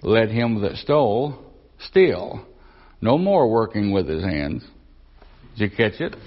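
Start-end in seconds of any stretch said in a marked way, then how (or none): 0.0-4.7 wrong phrasing, stole then steal set apart; wrong emphasis. Shown as a demonstration. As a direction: pause after stole, break before no more. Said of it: "emphasize it just a way where I can make it sound like this".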